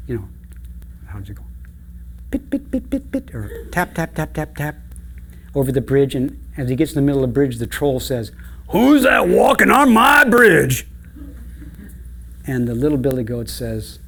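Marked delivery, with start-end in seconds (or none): 2.3-4.8 high, soft pitter-patter taps; tiny goat feet tapping lightly; light little hoofsteps. Acted. Storyteller imitating littlest goat crossing the bridge, light high taps. 8.7-10.9 loud, high, drawn-out troll challenge; roaring troll. Acted. Troll challenging little goat on his bridge, loud roar.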